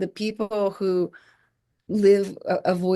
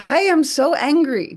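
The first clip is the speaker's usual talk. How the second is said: higher, louder mock fury; retold angry outburst; 7 semitones higher, 6 dB louder than usual. Teacher owning her anger in front of students, loud mock anger. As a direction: declare the anger plainly and forcefully. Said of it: "she got really angry, expressed it".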